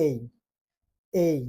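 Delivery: "এই" said as the English "ay" sound, held clearly; correct letter name A. Shown as a demonstration. ছাত্রদের সামনে শিক্ষক বর্ণ A দেখান: clear, careful "এই". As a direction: teach A as "এই", never flat "এ". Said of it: "এটার উচ্চারণ শিখব".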